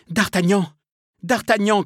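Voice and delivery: high, loud desperate call; acted urgent shout; pleading cry. A narrator's reading. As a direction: call out his name, begging to be saved. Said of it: "cria-t-elle".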